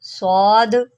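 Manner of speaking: सौद with a thick, darkened स; heavy saad. Shown as a demonstration. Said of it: "इसको हम मोटा करके पढ़ेंगे".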